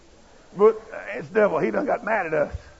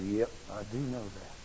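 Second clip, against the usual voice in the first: quiet reply; 7 semitones lower, 14 dB quieter than usual. Retold or imitated. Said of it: "I said".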